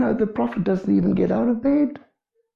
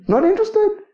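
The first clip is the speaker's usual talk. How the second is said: "Not interested" jumped much higher, sing-song and dismissive; mock dismissive. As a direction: toss off "Not interested" in a high mocking voice. Preacher voicing God's dismissal.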